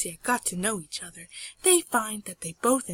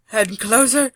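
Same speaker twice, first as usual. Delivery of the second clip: much louder, building tension; mounting suspense; 4 semitones higher, 12 dB louder than usual. A narrator's reading. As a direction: push this one harder toward the climax.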